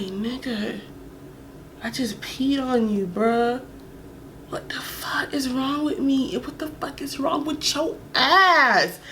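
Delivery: slow, hushed whisper; inner-voice whisper. Retold or imitated. Her private thought when the guy asks about food, whispered. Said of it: "thinking in my head".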